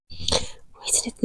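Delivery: whispering while the page is slow to load; soft unvoiced whisper, impatient. Real, not acted. To viewers while waiting for the book, whispering.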